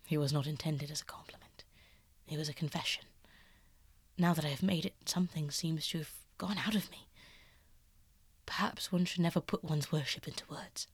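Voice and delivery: lightly voiced painter, half-hushed and earnest; soft-spoken Basil. Basil confessing to Dorian, hushed and earnest. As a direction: let it drop low and confiding.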